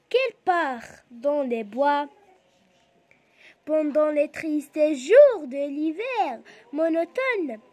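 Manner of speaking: loud, slow poem recitation; deliberate classroom recital with clear breaks; pausing at punctuation. Shown as a demonstration. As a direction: project each phrase, then stop briefly. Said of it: "On va s'arrêter un peu".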